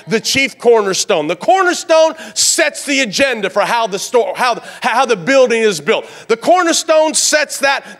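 high, loud and driving, a pastor whipping up the church; preaching at full force. Acted. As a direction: push every line up to the back row like a revival preacher.

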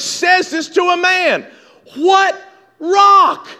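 high, loud, insistent preacher's delivery; impassioned pulpit climax building to a shout; full-force preaching. Real, not acted. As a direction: drive each phrase home at the top of your voice.

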